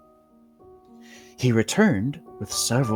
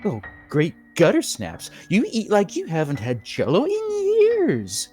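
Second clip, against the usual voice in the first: host voice; 9 semitones higher than usual. A narrator's reading.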